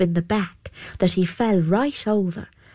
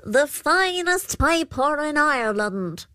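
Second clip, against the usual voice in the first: higher, proud fairy creature; trickster pooka; 8 semitones higher than usual. A narrator's reading. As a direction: boast like a sly trickster. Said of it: "And the Púca replied".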